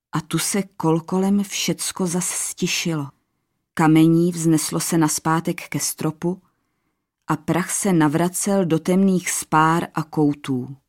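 usual speaking voice

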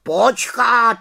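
high, loud sharp cut-in; raven interrupting. Acted. Raven Abraxas interrupting the little witch, high and loud. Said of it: "skočil jí do řeči Havran".

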